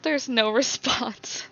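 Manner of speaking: amused, laughter cutting into the words; laughing. Real, not acted. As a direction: say it slowly through real laughter.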